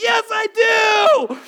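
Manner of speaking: high, drawn-out mock wail; imitated child's scream. Sobbing daughter contradicts dad, hammed-up high scream. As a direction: bawl it back like a terrified kid. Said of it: "like heaving sobs".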